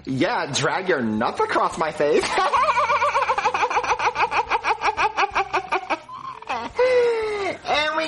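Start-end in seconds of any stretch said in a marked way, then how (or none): 2.3-7.6 shrieking hearty laughter. Real, not acted. Loud laugh after a joke.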